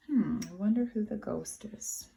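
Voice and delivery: usual speaking voice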